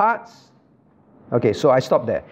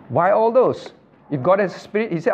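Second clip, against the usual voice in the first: ordinary delivery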